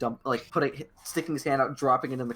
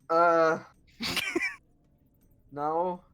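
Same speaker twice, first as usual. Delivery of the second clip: hill giant voice; 5 semitones higher, 3 dB louder than usual. Acted. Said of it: "hill giant goes".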